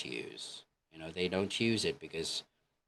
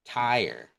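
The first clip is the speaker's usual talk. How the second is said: "tire" said loud and higher; word emphasised; 4 semitones higher, 7 dB louder than usual. Shown as a demonstration. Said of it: "for example, in the word".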